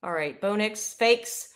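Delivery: ordinary talk, nothing marked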